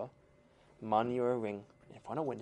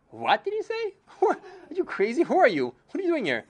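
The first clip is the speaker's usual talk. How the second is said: much higher, half-laughing disbelief; shocked outburst; 12 semitones higher, 6 dB louder than usual. Retold or imitated. Rich father shouting at the ragged stranger, shocked and incredulous. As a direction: gasp it out like he can't believe his ears.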